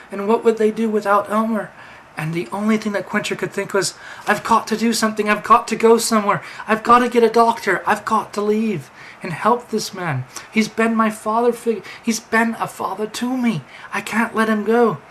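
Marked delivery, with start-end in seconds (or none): 4.3-15.0 panicked. Acted. Quinter panics over fallen Elmer.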